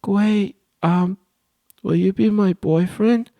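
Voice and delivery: put-on high, slow little girl voice; mock girly voice; girl voicing. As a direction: act out a shy girl asking to be friends.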